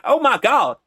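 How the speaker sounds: big southern US accent. Shown as a demonstration. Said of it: "like, Hick accent".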